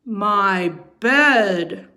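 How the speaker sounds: loud sputter. Acted. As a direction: sputter it out in shock. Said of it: "Papa Bear sputtered".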